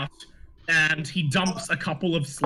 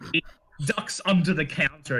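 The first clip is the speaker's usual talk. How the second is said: normal talking voice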